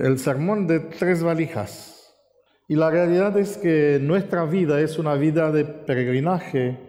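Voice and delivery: ordinary talk, nothing marked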